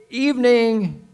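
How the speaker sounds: loud, high proclamation; declaimed scripture. Acted. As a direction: proclaim the verse to the congregation. Declaiming the verse at volume.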